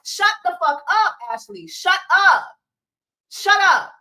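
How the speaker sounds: high, loud shut up; exasperated yell. Real, not acted. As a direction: yell her down, fed up. Yelling at Ashley on stream.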